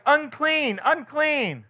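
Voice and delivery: high ringing cry of unclean. Acted. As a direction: call it out like a leper warning others. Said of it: "and cry".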